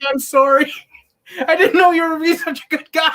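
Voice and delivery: high, loud movie-quote voice; acted apology. Quoting a film apology to Ted, loud high mock apology.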